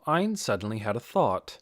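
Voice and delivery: plain reading voice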